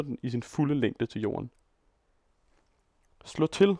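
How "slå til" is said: much higher frenzied scream. A narrator's reading. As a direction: scream it like a madman. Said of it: "skriger Mikolka". (vanvittigt skrig)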